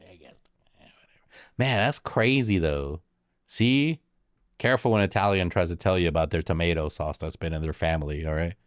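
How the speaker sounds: ordinary delivery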